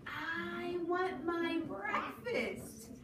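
the little chick asks for breakfast in a high voice. Acted. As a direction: whine it like a tiny bird. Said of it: "said the little chick yellow".

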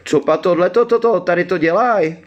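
very high-pitched, grumbling creature voice; gloomy forest creature. A narrator's reading. Uncomprehending forest creature asks what they are doing, gloomily, high-pitched. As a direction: puzzle aloud in a high creature voice. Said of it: "řekl chmurně".

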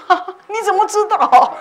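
high, loud, laughing disbelief; laughing astonishment. Retold or imitated. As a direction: gasp it out, amazed and amused. 惊讶鬼知道超度. (笑着高声惊问)

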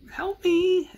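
squeaky high mock cry for help; mock plea. [name] mocking Dale with a squeaky plea. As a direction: pitch it way up like a desperate little prayer.

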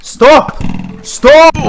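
high, loud, sharp bursts; panicked shout. Real, not acted. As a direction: shout to fend off an attacker. Attacked by monsters at spawner.

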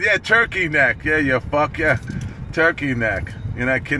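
ordinary delivery

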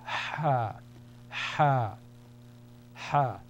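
Ha with a long push of air from the throat; Arabic letter sound demo; sustained breathy ha. Shown as a demonstration. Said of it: "a sustained expulsion of air".

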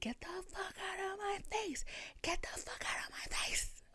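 high strangled squeal, held down in volume; thin, high pretend shriek; mock scream. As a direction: imitate screaming without the full volume. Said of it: "I started screaming".

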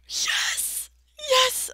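high loud cheering yes; triumphant yes. Real, not acted. Triumph on finding coal.

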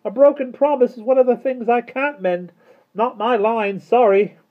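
very high, loud character voice; Mister Turvey's voice. A narrator's reading. Mister Turvey answers from the floor.

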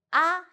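"ah" said high and clear; Chinese ah. Shown as a demonstration. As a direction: sing out "ah" as a sample. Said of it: "have four tones".

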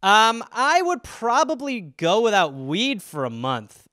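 ordinary delivery

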